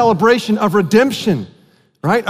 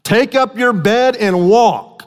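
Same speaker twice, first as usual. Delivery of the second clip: commanding call; 3 semitones higher, slower than usual. Retold or imitated. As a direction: call the lame man to rise. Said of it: "Jesus said to him".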